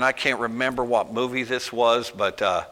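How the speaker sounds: ordinary talk, nothing marked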